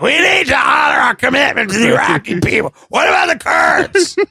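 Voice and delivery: high, strained rant; mock screaming. Fat guy coked out at a bar, mock screaming. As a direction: imitate a coked-up man screaming politics. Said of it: "You were just screaming".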